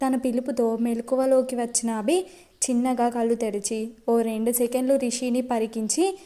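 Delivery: ordinary delivery, nothing marked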